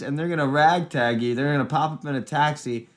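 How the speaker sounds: usual speaking voice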